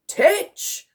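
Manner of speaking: high, loud call of a name. A narrator's reading. Admiral summoning the private, called out loudly. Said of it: "called out the Admiral".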